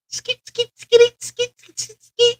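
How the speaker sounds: high skeet scratch sounds; quick high scratching mimicry; scratch noise. Imitating records being scratched.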